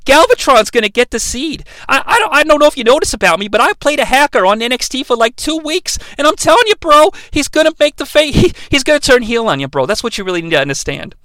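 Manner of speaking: high, rushed bro explanation; earnest bro. Retold or imitated. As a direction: imitate a bro breaking it down urgently. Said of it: "He's like, "Bro".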